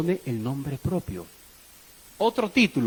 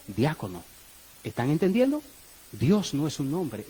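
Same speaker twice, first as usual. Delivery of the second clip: ordinary talk, nothing marked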